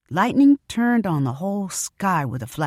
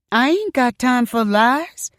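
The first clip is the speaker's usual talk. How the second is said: firm Mama; 7 semitones higher, 4 dB louder, slower than usual. A narrator's reading. Mama firmly answering customer.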